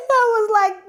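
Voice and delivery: laughing. Real, not acted. Laughing while retelling.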